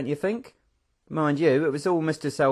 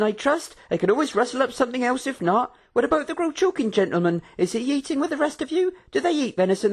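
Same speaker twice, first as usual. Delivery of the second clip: fussing hostess; 12 semitones higher, 4 dB louder than usual. A narrator's reading. Karina voiced, fussing hostess.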